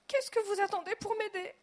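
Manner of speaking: high, loud desperate demand; frantic yell. A narrator's reading. Director yelling at the family, frantic. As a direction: yell at them to act.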